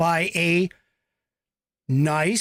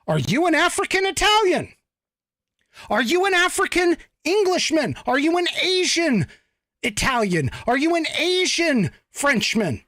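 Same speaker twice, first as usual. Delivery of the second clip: high, pointed mock questioning, pitched up; mock earnest question; 11 semitones higher than usual. Asking strangers about their heritage, high mock questioning. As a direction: pretend to quiz a stranger, voice lifted high. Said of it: "And you say".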